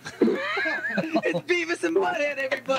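high, drawn-out cry through laughter; laughing disbelief. Real, not acted. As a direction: crack up while announcing the callers. Host delighted by celebrity prank callers.

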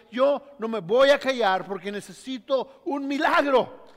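loud, insistent refusal to be silenced; defiant cry. Acted. Blind man refusing the crowd, defiant and loud. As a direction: refuse to stop, keep pushing.

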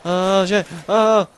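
loud alarmed cry; panicked shout. Real, not acted. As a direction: yell in sudden alarm. Panic rising in game.

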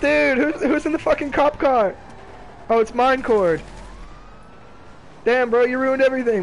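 high, loud, worked-up gamer shouting; excited yelling. Real, not acted. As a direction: yell it in disbelief. Cop car surprise while gaming.

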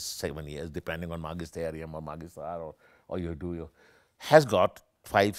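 ordinary talk, nothing marked

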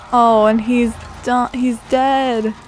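normal talking voice

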